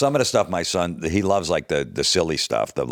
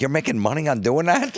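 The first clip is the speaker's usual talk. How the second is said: friends amazed; 9 semitones higher than usual. Retold or imitated. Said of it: "my friends that I grew up with, like".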